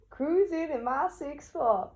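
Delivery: high-pitched playful rapper impression. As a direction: pitch it way up like a rapper mid-freestyle. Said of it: "I felt like [name]".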